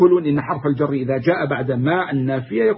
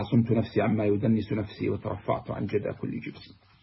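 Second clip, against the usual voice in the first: softer, hushed verse reading; poem recital; 6 semitones lower, 8 dB quieter than usual. Acted. Teacher quotes Buhturi's Sinniya to students, soft recital. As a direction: recite it low and measured.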